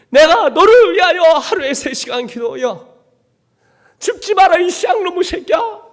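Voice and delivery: high, loud, slow pleading yell as the mother; tearful high cry of a desperate mother; mother's desperate shout. Retold or imitated. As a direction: shout it like a mother begging her son to live. Mother shouting at despairing son.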